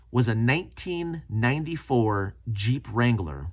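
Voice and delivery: usual speaking voice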